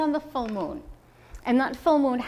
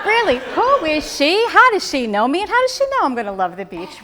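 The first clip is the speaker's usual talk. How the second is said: much higher and louder, laughing in disbelief; incredulous excited shout; 7 semitones higher, 9 dB louder than usual. Retold or imitated. To the little girl at the beach, loud laughing disbelief. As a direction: blurt it out, amazed and laughing. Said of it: "I'm like".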